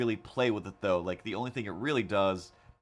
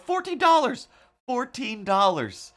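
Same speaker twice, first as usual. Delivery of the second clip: much higher, loud outraged cry; hammed-up exasperated yelling; indignant shriek; 8 dB louder than usual. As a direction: scream the price in disbelief. Indignant exclamation about price.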